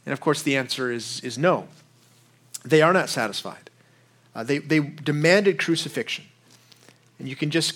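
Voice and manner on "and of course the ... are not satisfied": usual speaking voice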